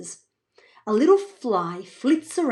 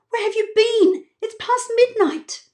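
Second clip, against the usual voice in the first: much higher, anxious questioning; worried mum; 12 semitones higher, 3 dB louder than usual. Acted. Mum hugging Evie, worried and high. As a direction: fret over her child.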